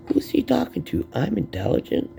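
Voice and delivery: high-pitched mock disbelief after a laugh; amused disbelief. Amused retelling of disbelieving thought.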